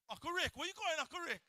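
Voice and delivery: high playful call; teasing call across the room; calling after someone. Real, not acted. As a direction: call out to the uncle walking off. Calling after leaving uncle.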